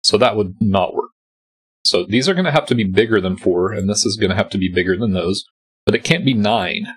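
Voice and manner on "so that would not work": normal talking voice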